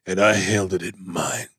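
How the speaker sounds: low, final tough-father reply; retold father's retort. Sly answering his daughter, low and final. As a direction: drop low for a firm last word. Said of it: "And he goes".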